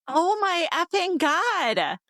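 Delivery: high, slow disbelief; stunned. Real, not acted. Friend reacting to the heroine's age, high slow disbelief. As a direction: drag it out in shock.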